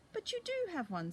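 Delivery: put-on high, bright child's voice; eager little girl. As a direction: pipe up like a sure little girl. Millie corrects her mum.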